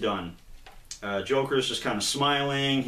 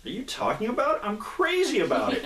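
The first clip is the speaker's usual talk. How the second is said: manic delight; 11 semitones higher than usual. Retold or imitated. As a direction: squeal it with crazed enthusiasm. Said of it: "It's like".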